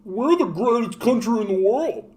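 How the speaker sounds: high, slow whiny put-on voice; wobbly, dopey flag-waving boast, pitched high; mock American braggart. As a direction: caricature a dim, boastful patriot.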